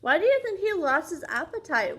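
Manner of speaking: plain reading voice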